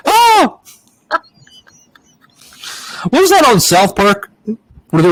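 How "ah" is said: put-on a quick high giggle like a little girl, on request. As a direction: squeal a tiny girl's giggle, much higher. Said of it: "Do it again".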